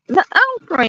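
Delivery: "okra" said as "okri", high and stretched; drawled okra. Retold or imitated. Wife to husband, mock Southern "okra". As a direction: ends "okra" on a long "-ri". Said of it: "however y'all say it".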